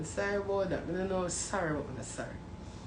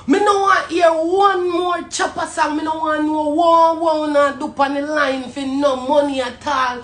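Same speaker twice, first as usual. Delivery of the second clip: loud, higher, shouting rant; furious rant; 7 semitones higher, 10 dB louder than usual. Real, not acted. Man ranting at dancehall selectors, loud and furious. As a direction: blast the selectors like a preacher on fire.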